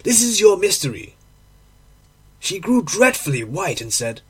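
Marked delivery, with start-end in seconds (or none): 0.0-1.1 higher-pitched, mildly accusing outcry; crying out. A narrator's reading. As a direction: confront them with a restrained cry. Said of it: "I cried".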